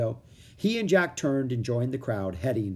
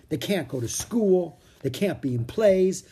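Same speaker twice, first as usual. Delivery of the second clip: put-on higher, slow girl's complaint; a girl fed up with the rules; Annie complaining; 7 semitones higher, 3 dB louder than usual. A narrator's reading. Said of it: "grumbled Annie".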